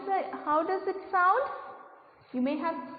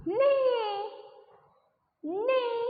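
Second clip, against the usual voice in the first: high drawn-out neigh; whinny like a pony; horse neigh; 8 semitones higher than usual. Shown as a demonstration. Teacher shows kids a horse's neigh. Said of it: "how the horse sound?".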